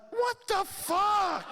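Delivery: very high, drawn-out comic yelp; appalled outburst. Retold or imitated. Son reacting to brother's restraining order blunder, appalled. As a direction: stretch it into a high, incredulous cry.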